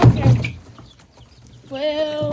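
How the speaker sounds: loud exclamation. Real, not acted.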